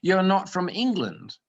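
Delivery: indignant mock-British scolding; silly British accent. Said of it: "this sort of silly accent".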